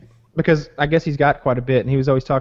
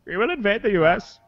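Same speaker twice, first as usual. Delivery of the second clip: lightly acted ISIS threat, higher pitch; scripted villain threat; 6 semitones higher than usual. Reading the ISIS script too early, mock threat. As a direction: play at declaring an invasion.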